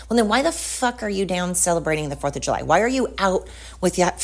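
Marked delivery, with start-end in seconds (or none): none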